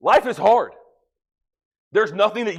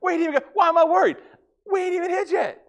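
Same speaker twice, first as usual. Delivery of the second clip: put-on much higher, fast and giddy, like an eager boy; excited kid; 12 semitones higher, much faster than usual. Preacher quoting the boy, high and eager. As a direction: rush it out like a thrilled kid who can't wait.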